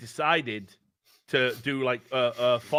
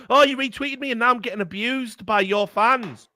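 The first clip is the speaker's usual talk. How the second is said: high, louder whiny complaint; mock whine; 10 semitones higher, 5 dB louder than usual. Mocking trolls who whine about abuse, high whiny voice. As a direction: play the troll crying victim. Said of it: "And then they'll go".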